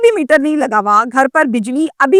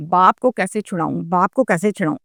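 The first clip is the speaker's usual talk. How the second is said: anxious inner murmur; 5 semitones lower, 5 dB quieter than usual. Retold or imitated. Son's anxious inner thought.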